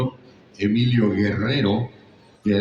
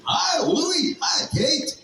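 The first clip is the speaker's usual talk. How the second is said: much higher, squeaky alien cry; imitating the original Alf greeting the family; slower than usual. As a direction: call out high like the English Alf. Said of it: "Que habla así".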